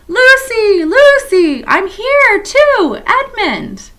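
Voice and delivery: very high, loud calling across the wood; full shout. A narrator's reading. Edmund shouting for Lucy across the snowy wood, very loud. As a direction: yell her name as loud as you can. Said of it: "and so he shouted".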